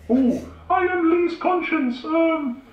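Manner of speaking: high, slow put-on silly voice; Carl Halbert voice. Conscience slipping into Carl Halbert's own voice, high and slow. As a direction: squeak it out as the comedian. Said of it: "What he sounds like is the comedian and semi-failed impressionist Carl Halbert".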